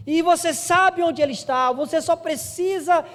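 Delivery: high, loud, impassioned sermon climax; full-force preaching. Real, not acted. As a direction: call the congregation with urgent, drawn-out emphasis. (pregação inflamada, voz alta e forte)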